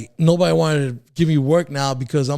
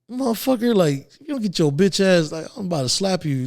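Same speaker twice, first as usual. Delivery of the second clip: higher pitched mock outburst at a nosy coworker; retold angry threat; 6 semitones higher than usual. Answering a coworker's question with a higher pitched mock threat. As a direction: snap back, raised pitch, half-joking. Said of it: "I was like".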